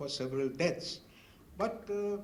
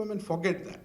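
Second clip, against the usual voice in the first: normal talking voice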